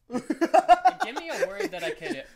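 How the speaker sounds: high, loud laughing request. Real, not acted. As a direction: ask while cracking up.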